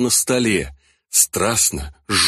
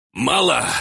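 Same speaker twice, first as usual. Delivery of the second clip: feigned annoyance; 4 semitones higher than usual. A narrator's reading. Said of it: "с наигранной досадой произнёс Гуран".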